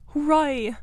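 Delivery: one jubilant, falling hurrah; sudden cheer. A narrator's reading. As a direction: cheer out your discovery. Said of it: "Suddenly the rat cried".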